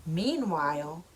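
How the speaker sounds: plain reading voice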